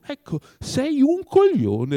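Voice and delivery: slow build to a high, loud insult; loud exasperated verdict. A narrator's reading. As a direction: draw it out, then let it explode. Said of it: "ripeté Oblomov".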